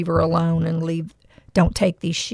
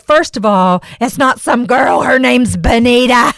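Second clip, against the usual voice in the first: louder, insistent and indignant; firm correction; 3 semitones higher, 7 dB louder than usual. Retold or imitated. Calling out the window to defend Benita, loud and firm. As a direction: set them straight, leaning on the name.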